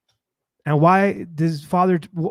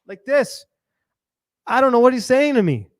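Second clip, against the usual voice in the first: ordinary talk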